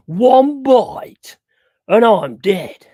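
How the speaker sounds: put-on high, loud Australian accent; Aussie accent, big and bold; Aussie impression. Quoting Steve Irwin in an Aussie accent. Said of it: "good Aussie accent".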